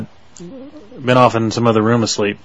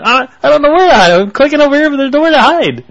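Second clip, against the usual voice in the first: flustered; 7 dB louder than usual. Retold or imitated. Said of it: "I'm going".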